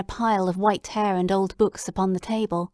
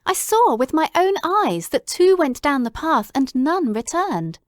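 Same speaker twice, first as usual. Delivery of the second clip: higher, louder insistence; urgent protest; 6 semitones higher, 5 dB louder than usual. Acted. Watson insisting to Holmes he saw the tracks, higher and louder. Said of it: "I cried".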